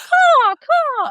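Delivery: shrill high crow caw, very loud; shrill screeching bird woman. Retold or imitated. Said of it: "we would start cawing".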